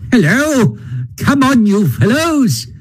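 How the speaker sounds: very high, hearty hail to friends; cheerful cry. A narrator's reading. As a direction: call out high and merrily. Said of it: "he cried cheerfully on catching sight of them".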